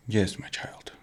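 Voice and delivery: whispered wise guru blessing; Gandhi voice. Retold or imitated. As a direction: breathe it like a serene sage. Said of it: "how Gandhi would speak".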